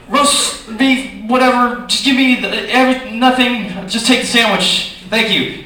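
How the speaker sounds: high, loud, flustered sandwich order; mad. Retold or imitated. Comic reliving ordering at Subway while furious, mad. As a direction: blurt the order, too angry to think. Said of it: "I was just mad".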